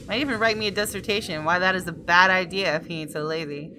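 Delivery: ordinary talk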